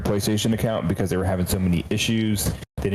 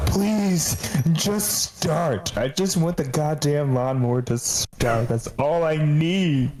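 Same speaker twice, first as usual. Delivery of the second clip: pleading prayer; 4 semitones higher, much slower than usual. Retold or imitated. Cartoon dad praying over a lawnmower that won't start, pleading. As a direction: imitate a desperate plea, high at first, then dragged out slowly. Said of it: "He's sitting there praying to the gods".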